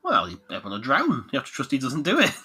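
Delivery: ordinary delivery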